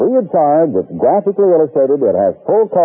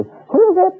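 put-on high, piping old lady voice; very high call through the door; old lady asking who's at the door; little old lady calling. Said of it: "then she'd say".

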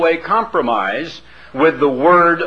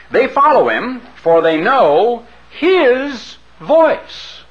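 higher and slower, "his" lifted; preaching emphasis; 8 semitones higher, slower than usual. Acted. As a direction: ring it out from the pulpit. Preacher climaxes on the scripture line.

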